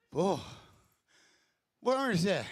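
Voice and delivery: loud call across the room. Real, not acted. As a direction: shout it to someone away. Calling out.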